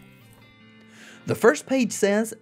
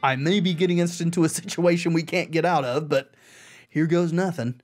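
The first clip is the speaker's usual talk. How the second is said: ordinary delivery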